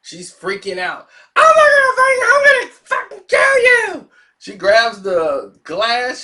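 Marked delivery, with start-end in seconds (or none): 1.4-4.1 much higher and louder, crying scream; hysterical scream. Retold or imitated. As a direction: shriek it in a total meltdown. Girl freaking out, screaming.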